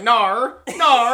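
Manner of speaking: Nar drawn out; mock British no. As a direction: say Nar like a Brit. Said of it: "they'd be like".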